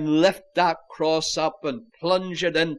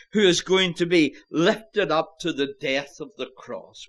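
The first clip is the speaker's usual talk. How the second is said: ordinary talk, nothing marked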